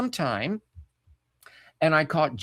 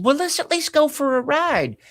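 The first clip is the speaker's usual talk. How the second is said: mother's voice; 12 semitones higher, 3 dB louder than usual. Retold or imitated. Mother quoted to stepdad.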